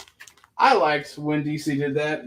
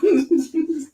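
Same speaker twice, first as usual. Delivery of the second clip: high laugh; 4 dB louder than usual. Real, not acted. Laughs after his accent bit.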